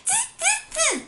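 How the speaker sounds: high-pitched teasing "tss" noises; three squeaky, hissed monkey sounds; monkey chatter. Acted. Monkeys mock the scolding peddler, high monkey chatter.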